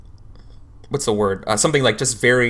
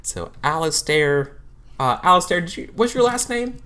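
counselor voice; 7 semitones higher than usual. Acted. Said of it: "I was like".

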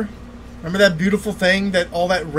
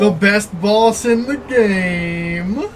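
sarcastic announcement; 3 semitones higher, 6 dB louder, much slower than usual. Real, not acted. Teasing about boss.

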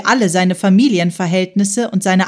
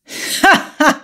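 sudden high burst of laughter; loud laugh; 7 semitones higher than usual. A narrator's reading. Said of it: "Auf einmal lachte er laut auf".

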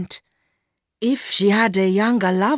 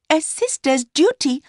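put-on high-pitched young woman's voice; Mercy, answering back; 9 semitones higher than usual. A narrator's reading. Mistress throwing her lover's words back, high young woman's voice. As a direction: echo him in a much higher voice.

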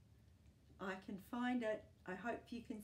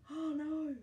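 higher, louder playful alarm; mock dismay; 7 semitones higher, 8 dB louder than usual. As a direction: gasp it out like a shocked storyteller. Dog ate the basket.

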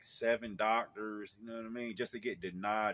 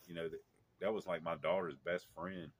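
ordinary delivery

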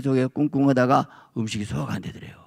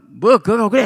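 curt dismissal; 8 semitones higher, 7 dB louder than usual. Retold or imitated. Husband dismissing her.

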